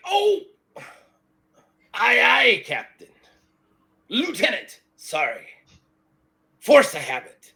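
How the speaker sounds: loud, high outburst, dropping into apology; flustered pirate sailor. Acted. Pirate answers his lieutenant with the wrong rank, flustered. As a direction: jump to attention, then sheepishly correct yourself.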